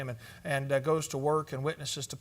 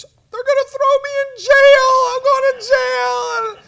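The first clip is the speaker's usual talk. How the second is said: very high, loud, wailing panic; shrill, high sobbing panic that eases a little at the end; imitated panicked sobbing; 15 dB louder than usual. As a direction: wail it like a terrified teen driver. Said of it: "couldn't hardly talk without sobbing and apologizing".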